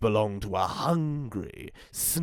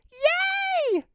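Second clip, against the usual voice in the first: delighted cry. Acted. Red hears Mum say yes, delighted high cheer. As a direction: squeal with joy. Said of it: "cried Red in delight".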